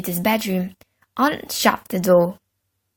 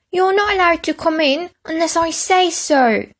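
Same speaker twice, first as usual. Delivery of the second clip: put-on high prissy boy; 8 semitones higher than usual. A narrator's reading. Peter scolds Henry.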